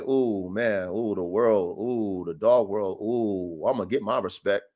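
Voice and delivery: low, reverent inner gloating; dreamy inner voice. Retold or imitated. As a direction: daydream about the perfect litter. His own excited thoughts.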